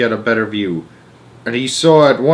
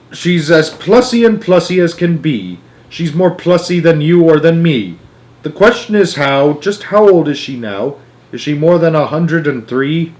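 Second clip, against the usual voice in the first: higher, bouncy rhyming voice; sing-song limerick; 5 semitones higher, 4 dB louder than usual. A narrator's reading. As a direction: recite it like a jaunty rhyme. Wonka recites a limerick.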